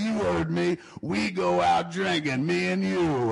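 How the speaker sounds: put-on high, slow drunken insistence; drunk insisting. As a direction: lean on it like a stubborn drunk.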